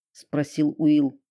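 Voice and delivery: ordinary delivery, nothing marked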